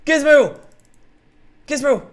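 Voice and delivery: loud high call across the lava. Real, not acted. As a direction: shout the cow's name from afar. Calling lost cow.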